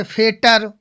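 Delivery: "फेटर" misread as fe-tar, no ch sound; wrong pronunciation. Shown as a demonstration. Teacher warning students how not to read feature, hard-T misreading. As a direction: say "फेटर" with a hard retroflex T in place of CH. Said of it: "अब ऐसा नहीं पढ़ेंगे".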